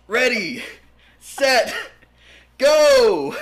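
building race-start shout, rising high; countdown shout. Acted.

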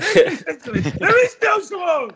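high, loud, slow bellow; Rocky trainer shout. Retold or imitated. Trainer at the gym, slow high shout. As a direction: drag out the shout like a movie coach. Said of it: "he thought he was a Rocky".

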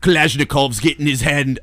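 lightly put-on Russian accent; a hint of a Russian accent. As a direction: toss off a quick mock Russian accent for a spectator. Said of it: "I'm doing this accent for Russian dude".

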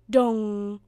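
long, loud monotone dong; clock chime. A narrator's reading. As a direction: ring out like a striking clock. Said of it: "went the clock".

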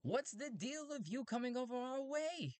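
very high robot voice; strange robot. Acted. Robots questioning the traveler, very high voice. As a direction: squeak the challenge way up high.

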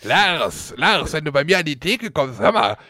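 lightly voiced rough butcher lady; a hint of a brash shop woman. Bertha addresses Lars at counter.

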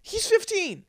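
high mocking mom impression. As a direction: mimic her as shrill and clueless. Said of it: "You're just shouting out".

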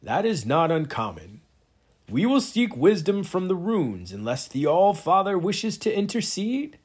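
high, ringing address to the hall; ceremonial proclamation. Acted. Presider announces they will seek wisdom from runes, high ringing proclamation. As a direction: proclaim it grandly, pitch lifted.

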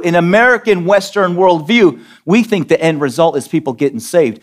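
ordinary talk

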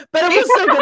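loud, high burst of enthusiasm; real fangirl gushing; gushing excitement. Real, not acted. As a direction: rave about a favorite book. Gushing over a book she binged.

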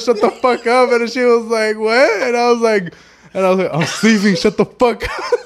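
high, loud, fighting back real laughter; words breaking into laughs; laughing. Real, not acted. Retelling the phone exchange, laughing through it. Still cracking up while retelling.

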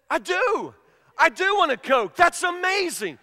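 high, loud, hammed-up astonishment; amazed and eager. Husband answering wife's offer of a Coke, acted amazement. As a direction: gush as if your mind was just read.

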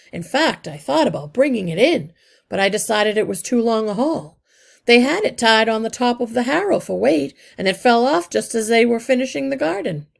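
a practical worker reporting plainly; brisk engineer rat. A narrator's reading. Arthur the engineer explains.

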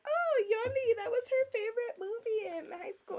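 high lilting mom voice. Retold or imitated. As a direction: gush like a fond mother. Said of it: "my mom was like".

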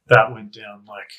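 ordinary talk

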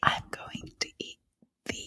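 breathy unvoiced ASMR whisper, slow. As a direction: whisper close to the mic like an ASMR girl. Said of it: "and she goes".

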